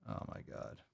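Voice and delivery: whispered disgust; whispered aside. Real, not acted. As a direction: breathe it out under your breath.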